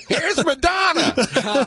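very high, drawn-out song intro; early DJ voice. Acted. Host introducing [name] as his younger self, high DJ voice. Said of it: "my early D J voice".

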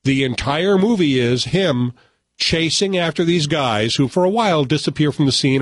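normal talking voice

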